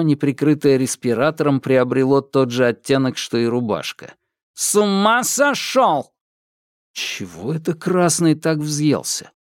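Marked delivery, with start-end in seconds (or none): 4.5-6.1 metallic patrolman. Acted. Patrolman yells, red-faced.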